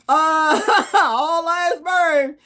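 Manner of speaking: loud, high, slow drawn-out wail; wild outburst. Acted. Speaker declaring everyone burns, wild high wail. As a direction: laugh and wail it out. Gleeful doom pronouncement.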